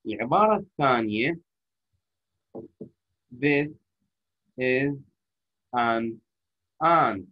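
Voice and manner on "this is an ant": each word said separately with pauses. Shown as a demonstration. Teacher reading each word apart to show the mistake. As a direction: break it into single separate words. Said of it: "قريت كل كلمة لحالها".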